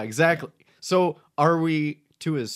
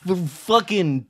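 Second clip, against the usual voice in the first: proud yokel descendant; 4 semitones higher, 8 dB louder than usual. Acted. Descendant defending statue heritage.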